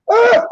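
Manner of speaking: very high squeaky yawn sound; imitated bird yawn. A man copying his bird's answering yawn, very high. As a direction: yawn back like a little bird.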